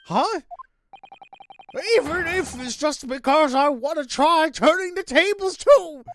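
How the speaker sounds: put-on the same old man voice, worked up; old man juror. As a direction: boast it like a feisty old juror. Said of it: "that's my typical old man accent".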